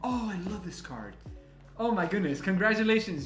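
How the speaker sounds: ordinary talk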